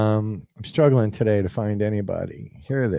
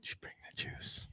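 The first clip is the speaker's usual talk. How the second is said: whisper; 26 dB quieter than usual. Acted. Co-host echoing the catchphrase to viewers in a whisper. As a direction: echo it as a soft whisper.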